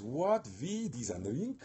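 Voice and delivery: theatrical, half-operatic incredulous question; shocked disbelief. Retold or imitated. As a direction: balk at an outrageous demand. Voicing Wotan's shocked refusal.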